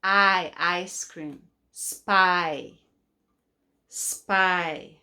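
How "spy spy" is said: "Spy" with the long eye sound; long I blend. Shown as a demonstration. Teacher to kids, modelling the long i in "Spy". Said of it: "Long I".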